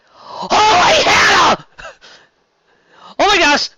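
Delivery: loud, high stunned exclamation; shocked outburst. Real, not acted. Reader reacting to a shocking chapter, loud astonishment. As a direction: blurt it out in genuine shock.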